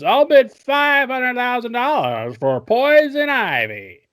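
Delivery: high, drawn-out holler; slow, over-excited high shout; shouted auction bid. Acted. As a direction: yell a hammed-up bid across the room. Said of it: "this guy in the audience".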